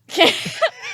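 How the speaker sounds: sudden high loud laugh; burst of laughter. Real, not acted. Bursts out laughing mid-story.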